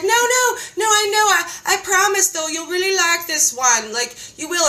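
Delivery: high, eager, coaxing voice; pleading friend. Retold or imitated. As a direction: beg sweetly, voice way up. Friend pleading about the diet.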